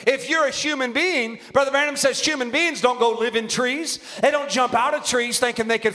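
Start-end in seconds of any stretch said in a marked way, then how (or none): none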